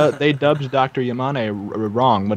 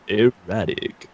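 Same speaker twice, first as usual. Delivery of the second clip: slow drawn-out; 3 semitones lower, much slower than usual. Pretend. Drawn-out word.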